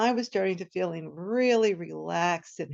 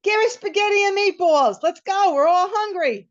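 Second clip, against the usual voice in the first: much higher, louder impatient shout; 11 semitones higher, 7 dB louder than usual. Retold or imitated. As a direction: yell the order to end it. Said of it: "my brother shouts".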